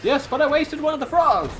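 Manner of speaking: high, loud protest; exasperated complaint. Pretend. Protesting a wasted frog to a teammate, high exasperated cry. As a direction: wail it at your teammate.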